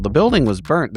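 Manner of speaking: ordinary delivery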